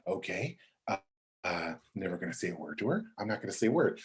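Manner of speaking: soft, low note to self; hushed self-talk. Retold or imitated. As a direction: keep it secretly to yourself. Acted private resolve to stay silent.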